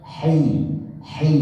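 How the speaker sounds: hi voiced as a throat sound with an i vowel; kasrah hi. Shown as a demonstration. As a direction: say hi from the throat, short and light.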